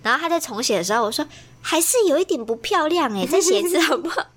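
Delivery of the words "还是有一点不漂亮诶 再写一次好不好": higher, pressing "不漂亮诶" with a laugh, nudging again; fussy tutor who won't let it go; demanding. Retold or imitated. Pushing the little girl to rewrite, fussy and insistent. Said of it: "我就很苛求啊".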